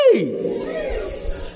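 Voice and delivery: sudden high yelp of hey; quick shocked hey; surprised hey. Real, not acted. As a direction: jump up on a startled hey. Startled exclamation.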